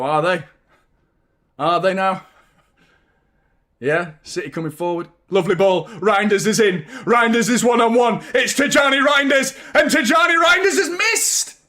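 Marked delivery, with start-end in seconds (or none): 5.3-11.6 building from brisk call to a high shouted miss; excited commentary. Acted. Fan commentating the chance, building to a high shout. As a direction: call it like a commentator, rising to the climax.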